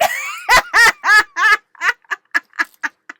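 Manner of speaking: loud, high burst of laughter; cracking up, long and loud; real laugh. Real, not acted. Planner fan amused at herself, big laugh.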